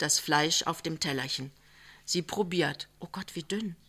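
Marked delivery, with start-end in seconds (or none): 3.0-3.8 soft, startled dismay; dismayed blurt. A narrator's reading. Young wife tasting thin soup, soft dismay. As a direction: let it slip, quietly shocked. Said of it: "sagt sie unwillkürlich".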